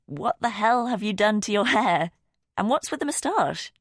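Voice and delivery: high, loud teasing disbelief. A narrator's reading. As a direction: tease dad about his new look. Teasing dad about his hair.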